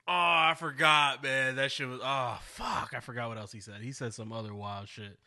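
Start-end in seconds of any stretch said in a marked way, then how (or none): none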